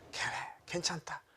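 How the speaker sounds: hushed, breathy comfort; tender reassurance. Acted. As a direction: soothe gently, almost whispering, as God would. Voices God comforting the believer.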